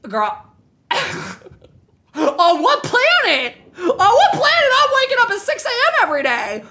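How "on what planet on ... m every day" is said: high, half-laughing disbelief; incredulous outburst. Real, not acted. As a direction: squeal the question, amused and appalled. Incredulous at daily alarm.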